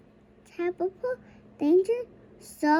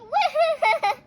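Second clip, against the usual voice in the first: child mimicking crying; 9 semitones higher than usual. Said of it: "the dwarfs say".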